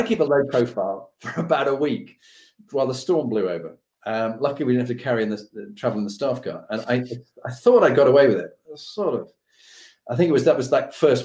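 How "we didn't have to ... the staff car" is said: ordinary talk, nothing marked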